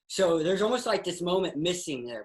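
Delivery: ordinary talk, nothing marked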